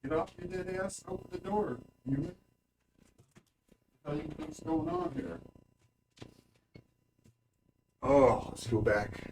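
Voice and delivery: speaking from across the room; far off mic. Real, not acted. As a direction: voice fading away from the microphone. Away from mic letting cat out.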